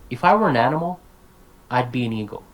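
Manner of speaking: "If I were an animal" as one rising chunk, pause, "I'd be an eagle" falling; two chunks. Shown as a demonstration. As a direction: split after "animal", each half said as one word. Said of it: "good example of chunking".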